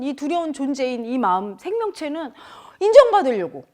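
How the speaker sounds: usual speaking voice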